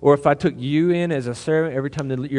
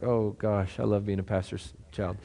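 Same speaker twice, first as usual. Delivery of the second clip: put-on a light, softer teasing sigh of a pastor's kid; mock sarcastic daughter; 5 semitones lower, 9 dB quieter than usual. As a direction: tease her gently, as if she rolled her eyes. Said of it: "She's like".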